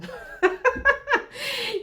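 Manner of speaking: high burst of laughter; real high-pitched giggle; self-amused laugh. Real, not acted. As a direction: laugh at your own muddled counting. Laughs at losing count of years.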